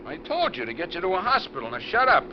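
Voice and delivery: furious command from the driver's seat; angry shout. Acted. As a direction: snap at him to stop talking. Kidnapper snapping at his captive.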